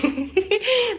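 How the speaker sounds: high, bright laugh bursting out; burst of laughter. Real, not acted. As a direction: laugh out loud at the memory. Laughs at own story. (gargalhada alta e aguda)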